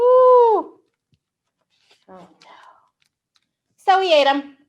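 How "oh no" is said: high, loud cry of dismay; hammed-up shock; mock alarm. Reader pretending to be shocked for the kids.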